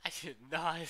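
loud, high shrieking denial; panicked yell. Real, not acted. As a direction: yell it in disbelief. Game panic.

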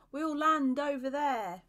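loud, high, drawn-out call. A narrator's reading. Joshua pointing the others to the riverbank, calling out. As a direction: call it long and loud across the raft.

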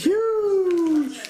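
squeaky high exclamation. Real, not acted. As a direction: squeal the word out. Squeaked reply.

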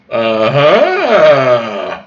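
long swooping uh-huh; drawn-out mock realisation; scheming uh-huh. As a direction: let the sound rise and slide back down. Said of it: "and went".

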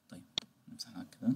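very soft, low whisper; under the breath; whispered aside. Real, not acted. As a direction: murmur to yourself while wiping the board. To self while erasing the drawing.

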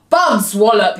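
loud giant's boom; storybook giant outburst. A narrator's reading. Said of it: "he boomed".

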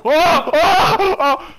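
high laughing screams; frantic, half laughing, half terrified; panicking gamer. Real, not acted. Bear attacking outside the car.

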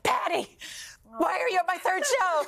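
retold high-pitched squeal to an idol; starstruck disbelief.

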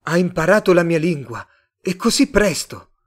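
loud, high astonishment; amazed. A narrator's reading. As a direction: exclaim it in amazement. Tomás amazed the Martian learned English.

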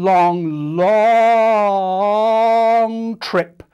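growing emphasis on "long"; stretched building long. Shown as a demonstration. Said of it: "long, long, long".

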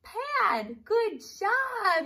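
high cheerful praise; excited. Real, not acted. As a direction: cheer them on. Teacher praising kids.

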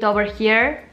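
speaking around fingertip; finger resting on lip. Real, not acted. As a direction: lip held down slightly. Seen 2: fingertip pressed to lower lip, pointing at teeth, throughout speech.

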